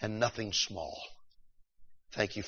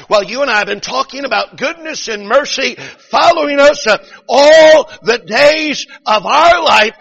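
loud, high revival cadence; preacher's retold shouting; 9 semitones higher, 10 dB louder than usual. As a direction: build to a high, stretched pulpit shout. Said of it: "He began to shout even more".

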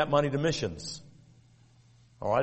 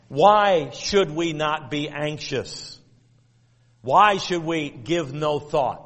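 slow rhetorical question; slower than usual. Acted. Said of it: "rhetorical questions to congregation".

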